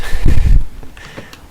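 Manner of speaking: loud breathy laugh. Real, not acted. Laughs mid-apology while tired.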